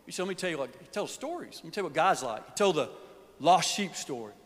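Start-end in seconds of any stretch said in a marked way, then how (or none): none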